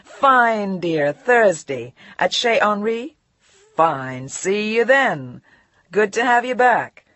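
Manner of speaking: loud, breezy, insincere; hollow heartiness. Acted. Editor Angus breezily faking welcome. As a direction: brush her off with charm. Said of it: "Bullshit, but more of the same dialect".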